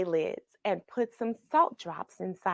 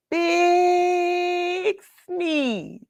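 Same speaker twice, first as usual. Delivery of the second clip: drawn-out build-up; 9 semitones higher than usual. Acted. Building up to sneeze.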